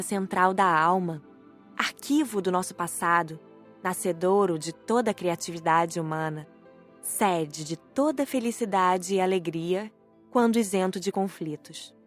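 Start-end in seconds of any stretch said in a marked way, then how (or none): none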